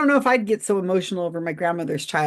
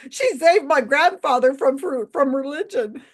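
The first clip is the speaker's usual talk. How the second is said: higher, louder, tickled repeat of a quote; high-pitched amused mimicry; amused incredulous quoting; 7 semitones higher, 3 dB louder than usual. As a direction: quote it as if it's hilarious, voice lifting toward a laugh. Repeats quote she found hilarious.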